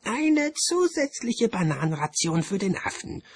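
high, unruffled bursar; calm bursar. A narrator's reading. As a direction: announce it composedly in a high official voice. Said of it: "sagte der Quästor ruhig".

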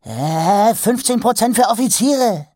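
high, loud, rising panic; the trader calling after Mumm in a panic as he walks away; urgent panic. Acted. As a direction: plead urgently, nearly squealing. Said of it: "drängte Ruin ... vage Panik in seiner Stimme".

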